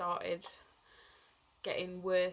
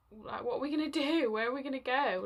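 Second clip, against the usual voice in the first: mildly panicked, higher and louder; a touch of helpless urgency, raised voice; retold worry; 4 semitones higher, 5 dB louder than usual. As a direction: relive a flustered moment of not knowing. Retelling panic while stranded by the fire.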